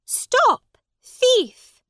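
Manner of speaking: high, loud alarm; robbed woman shouting after the thief; shouted. A narrator's reading. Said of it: "shouted the woman".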